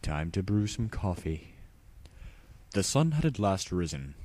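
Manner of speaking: usual speaking voice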